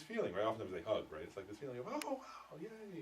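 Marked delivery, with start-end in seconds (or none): 1.9-3.0 delighted gasp. Retold or imitated. Delighted reunion feeling acted out.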